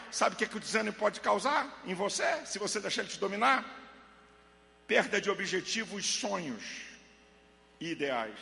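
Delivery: usual speaking voice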